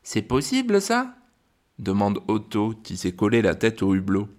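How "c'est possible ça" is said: put-on high, eager girl's voice; Alice voiced. A narrator's reading. Girl spotting a Christmas-ball planet, high and amazed. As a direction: pipe up high with wonder. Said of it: "Alice jette un œil … et dit".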